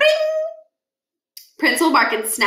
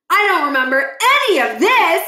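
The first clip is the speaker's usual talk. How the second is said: put-on goofy kid voice; 8 semitones higher, 6 dB louder than usual. Stuart confused.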